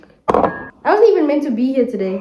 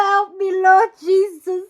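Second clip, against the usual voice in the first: laughing plea; 8 semitones higher, 4 dB louder than usual. Real, not acted. Laughing despair at mistake.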